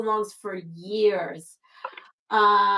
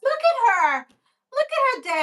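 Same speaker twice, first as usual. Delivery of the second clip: cooing at a dog; 14 semitones higher, 4 dB louder than usual. Real, not acted. A host cooing at a guest's old Chihuahua in baby talk. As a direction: melt over the little dog.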